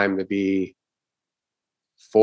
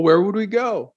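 coy reply; 8 semitones higher than usual. Retold or imitated. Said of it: "she's like".

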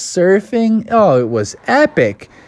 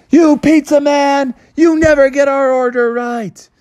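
angry yelling; 11 semitones higher, 3 dB louder than usual. Acted. Scorpions shaking fists, yelling.

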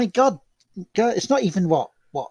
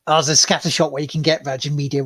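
ordinary talk

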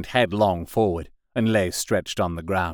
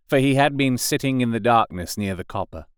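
ordinary delivery, nothing marked

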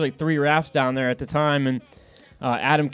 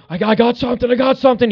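much higher, louder, mock-excited fish call; 9 semitones higher, 6 dB louder, faster than usual. Fishing partner calling out a bite, mock-excited loud. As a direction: shout it like the fish just hit. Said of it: "he was actually louder than he usually is".

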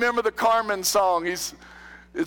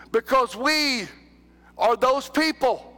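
ordinary talk

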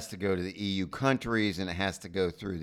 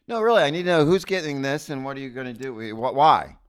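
fast, loud, pushy teller questions; insistent teller; 5 semitones higher, 7 dB louder than usual. Pretend. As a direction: press the customer for answers.

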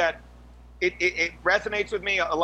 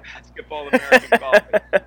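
mock correction; 3 semitones higher, 11 dB louder than usual. Joking correction of a term.

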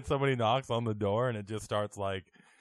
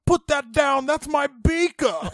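high, loud mock yelling; science teacher under attack; 12 semitones higher, 7 dB louder than usual. Imitating the beaten high school science teacher, panicked yell. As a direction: cry out as a teacher being beaten. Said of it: "beating of a high school science teacher".

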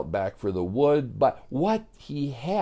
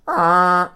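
loud, high imitated honk; goose honk; 8 semitones higher, 6 dB louder than usual. Storyteller honks as Joey grabs a goose, loud honk. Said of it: "It honks like a goose".

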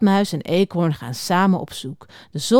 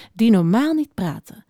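ordinary delivery

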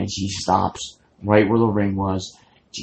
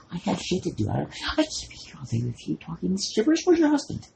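put-on high, impatient old lady rant; cranky old lady; 9 semitones higher, 5 dB quieter, faster than usual. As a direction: huff off in a higher old lady voice. Acting the old lady leaving.

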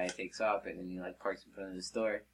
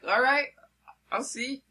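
high-pitched fisherman imitation; loud high start, rising like a dare; mock defiance; 6 dB louder than usual. Fisherman answering the worker's warning, mock defiance.

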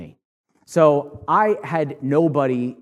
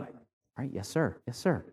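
meek retold yes sir; 3 semitones lower, 11 dB quieter than usual. Kid meekly obeying dad.

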